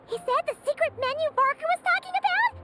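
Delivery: tumbling out words in delight; breathless foodie excitement, sped up; excited. Acted. Paimon thrilled about secret menu.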